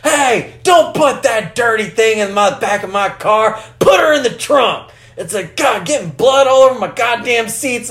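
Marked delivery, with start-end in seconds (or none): 0.0-4.9 mock sheriff bark. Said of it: "He's like". 5.6-7.9 mock gripe. Imitating the sheriff griping about blood on his seats, mock shout.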